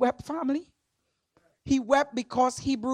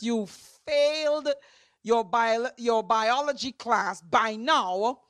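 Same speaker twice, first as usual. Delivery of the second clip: ordinary delivery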